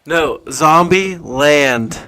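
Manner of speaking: very slow, split into two words; to someone hard of hearing. Real, not acted. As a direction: spell it out slowly.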